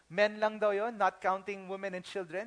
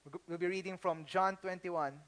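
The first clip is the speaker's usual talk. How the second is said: usual speaking voice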